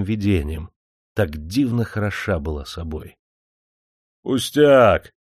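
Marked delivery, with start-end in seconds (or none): 4.2-5.1 gloomy dismissal. A narrator's reading. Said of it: "поугрюмил демидовский крепостной".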